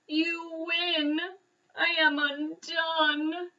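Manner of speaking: slow, high wailing lament; blubbering defeat. A narrator's reading. Bear concedes to the mouse, wailing. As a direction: sob out the surrender. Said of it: "he blubbered".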